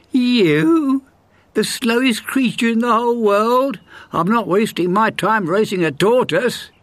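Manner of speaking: high, scornful hare voice; mocking hare. A narrator's reading. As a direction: sneer at the tortoise. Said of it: "The hare laughed".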